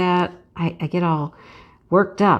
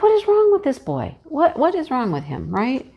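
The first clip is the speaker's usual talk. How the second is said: exasperated disbelief; 7 semitones higher than usual. Retold or imitated. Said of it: "I'm like".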